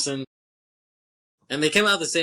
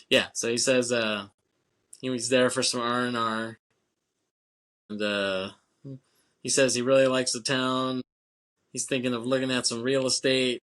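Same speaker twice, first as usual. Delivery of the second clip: ordinary talk, nothing marked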